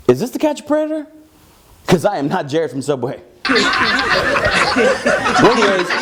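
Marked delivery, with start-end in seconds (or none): 0.1-1.0 alarmed. Retold or imitated. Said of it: "I'm like". 3.4-4.7 a quick, breathy laugh at his own joke; short unvoiced chuckle. Real, not acted.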